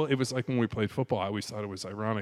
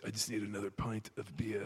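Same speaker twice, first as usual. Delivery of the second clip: put-on Scottish accent; 7 dB quieter than usual. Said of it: "you do the accent".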